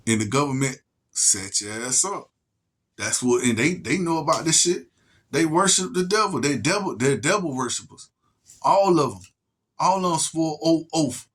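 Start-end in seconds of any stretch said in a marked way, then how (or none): none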